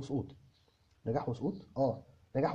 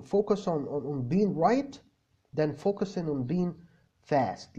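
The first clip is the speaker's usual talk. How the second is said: slow emphasis; much slower than usual. Shown as a demonstration. Coaching students, stressing the advice.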